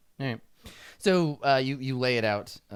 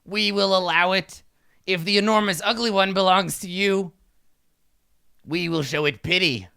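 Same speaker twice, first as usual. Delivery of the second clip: higher, louder regal proclamation; imperious kobold king; 6 semitones higher, 6 dB louder than usual. Acted. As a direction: pronounce judgement like a pompous ruler. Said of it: "he, uh, he says".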